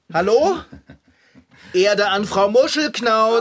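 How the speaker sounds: loud call to snap her out of it; shouting. Acted. Figarino shouts at Frau Muschelknauz to wake her. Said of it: "warum schreist du mich denn so an".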